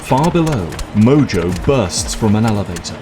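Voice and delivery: ordinary talk, nothing marked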